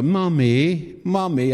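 lightly voiced small child calling; a hint of a toddler calling her mum; little girl's voice. Preacher imitating a small girl calling her mum, lightly voiced. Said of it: "I heard this little voice go".

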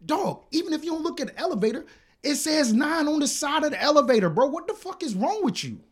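very high, exasperated tirade; losing it. Retold or imitated. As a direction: lay into him in high-pitched disbelief. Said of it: "I lost it".

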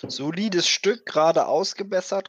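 high, eager trader voice; salesman pitch. Acted. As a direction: sell it like a market trader. Salesman praising the wagon. (hohe, eifrige Händlerstimme)

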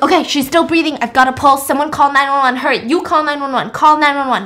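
doctor directing others during CPR; urgent commands. Retold or imitated. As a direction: bark orders at the crowd. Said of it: "he's like".

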